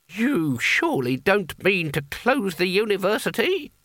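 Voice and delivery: put-on high, wavering old wizard; anxious, tremulous plea; voice trembling. A narrator's reading. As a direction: quaver the question in disbelief. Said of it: "his voice trembling".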